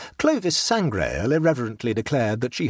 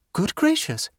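surprised; 12 semitones higher than usual. A narrator's reading. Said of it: "exclaimed Jane".

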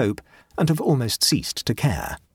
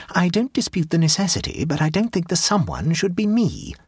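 a hint of an exasperated wife, pitched up; Janetta voiced higher; 7 semitones higher than usual. A narrator's reading. Wife refusing to write another thank-you, lightly voiced higher.

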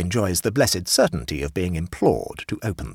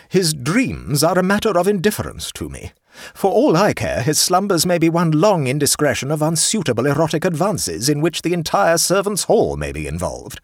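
high, loud, imperious aunt; haughty aunt; 9 semitones higher, 5 dB louder than usual. Acted. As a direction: pronounce it like a grand dowager. Voices the aunt.

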